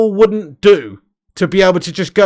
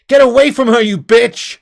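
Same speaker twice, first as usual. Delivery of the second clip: higher, louder mock shout of the line; movie quote yell; 5 semitones higher, 6 dB louder than usual. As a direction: bellow it like the movie heroine protecting the child. Quoting Aliens climax line.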